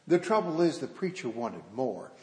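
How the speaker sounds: ordinary delivery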